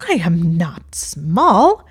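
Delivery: loud strangled denial; choked protest. A narrator's reading. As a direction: force it out, choking with indignation. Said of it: "he choked".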